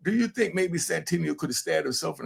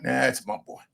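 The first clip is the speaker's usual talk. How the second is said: normal talking voice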